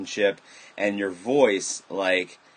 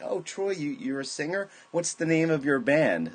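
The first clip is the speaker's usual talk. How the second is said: a hint of a curious older aunt, pitched up; elder aunt; 7 semitones higher than usual. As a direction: lightly imitate an aunt's surprised questions. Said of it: "an elder aunt or somebody".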